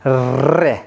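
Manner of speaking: रे with the tongue rolled fast in a clear trill; teacher rolling रे for the class to repeat; trilled re demo. Shown as a demonstration. Teacher asks students to repeat after him, rolled रे. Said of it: "एक बार दोहराइए".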